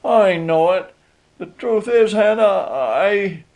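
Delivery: high-pitched, meek admission; meek husband faltering into an explanation. A narrator's reading. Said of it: "said he meekly".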